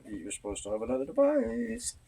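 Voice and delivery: rising high on "another device"; singsong reminder. Real, not acted. Reminding someone they're supposed to have another device, singsong.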